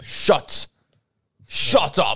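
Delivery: "Shut. Shut up." with every T sharply released; crisp T's. Shown as a demonstration. American friend imitating the British with crisp T's. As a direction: bite the T in "Shut up" hard. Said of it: "Their T's are very crisp".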